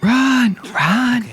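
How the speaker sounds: high, pushed urging to flee; urgent high run. Real, not acted. Friend urging the player to flee, high and urgent.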